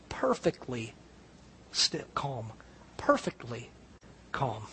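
very soft, low and measured; hushed calm. Retold or imitated. Preacher describing the sea after the storm, hushed. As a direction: let the voice settle into stillness.

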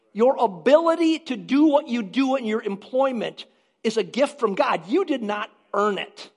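ordinary talk

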